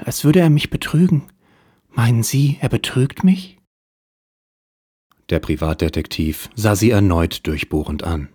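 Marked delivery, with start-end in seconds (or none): 0.0-3.6 wealthy client starting her tale; femme fatale voice. Acted. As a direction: play the helpless rich wife.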